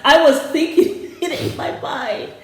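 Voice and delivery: high-pitched, laughing confession. Real, not acted. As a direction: let a real laugh break into the sentence. Amused confession of same thought.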